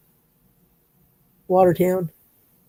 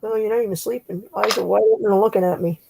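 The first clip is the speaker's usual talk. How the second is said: ordinary delivery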